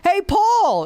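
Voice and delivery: high loud call of a name; sudden high loud shout of a name. Retold or imitated. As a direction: call out Paul sharply before the blow. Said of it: "then he's like".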